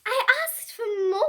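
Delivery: high, loud indignant bellow; a cranky dog roaring his complaint; bellowing in dismay. A narrator's reading. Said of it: "bellowed Pig in dismay".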